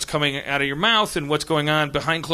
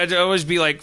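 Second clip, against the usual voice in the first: ordinary talk, nothing marked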